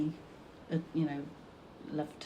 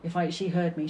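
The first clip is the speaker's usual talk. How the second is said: ordinary talk, nothing marked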